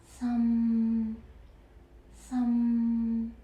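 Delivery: some held flat and low; low level tone. Shown as a demonstration. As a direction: keep some low and even. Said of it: "The sixth tone is some".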